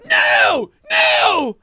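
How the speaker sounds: hammed-up high, loud scream; horrified trainer. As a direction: shriek as if witnessing a disaster. Acted trainer horrified at Skittles.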